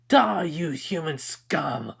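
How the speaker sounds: high, slow, drawn-out battle yell; demons' attack cry. Acted. Demons lunging at Tanjiro, high drawn-out menacing yell. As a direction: stretch DIE out high, falling away.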